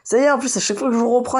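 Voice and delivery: high, loud exasperated rant; raging gamer. Real, not acted. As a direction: rant at the game, fed up. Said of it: "j'ai envie de péter un câble".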